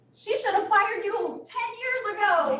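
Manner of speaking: high, slow put-on official voice; mock officials' verdict. Hearing officer ruling, high and slow. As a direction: play the hearing officer ruling against them.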